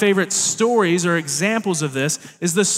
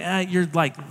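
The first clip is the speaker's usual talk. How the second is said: usual speaking voice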